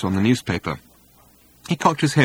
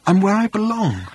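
high, choked-up, hushed voice; overcome, in a high emotional half-whisper; 10 semitones higher than usual. A narrator's reading. Arthur home at last, emotional whisper. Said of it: "in an emotional whisper".

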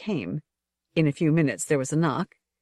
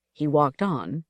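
ordinary delivery, nothing marked

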